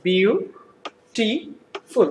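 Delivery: Beautiful very slowly, in three parts; syllable by syllable. Shown as a demonstration. Teacher showing how it is pronounced, beautiful in three slow syllables. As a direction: pause after each syllable of beautiful: বিউ, টি, ফুল. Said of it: "উচ্চারণ কিভাবে করা যাচ্ছে".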